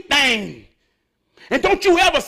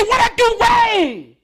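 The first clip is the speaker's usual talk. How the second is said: higher, louder, slow and hurt; wounded, asking what went wrong; confused plea; 8 semitones higher, 3 dB louder, slower than usual. Retold or imitated. The Energizer asking why he was cut off. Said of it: "And the Energizer say".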